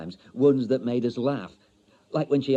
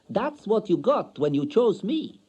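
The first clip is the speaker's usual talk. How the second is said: high, loud, cutting voice; wife taunting her husband; cold foreign wife; 10 semitones higher, 4 dB louder than usual. A narrator's reading. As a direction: deliver the sting proudly.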